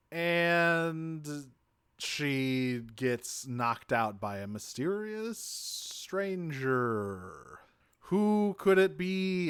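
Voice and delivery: slow, drawn-out mystery narration; mock suspense. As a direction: stretch it out like a teaser, lifting the question. Narrator feigning suspense over stranger.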